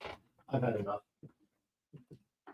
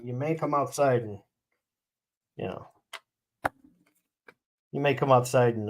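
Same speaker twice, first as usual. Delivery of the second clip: ordinary talk, nothing marked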